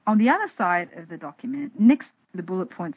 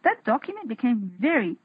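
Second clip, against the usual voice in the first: ordinary talk, nothing marked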